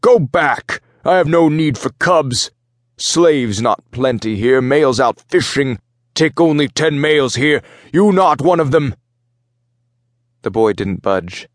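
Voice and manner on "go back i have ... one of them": raised, forceful Minotaur voice in broken speech; yelling commander. A narrator's reading. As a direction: order the boy away in a high, strained shout. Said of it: "the Minotaur ship commander yelled at the boy".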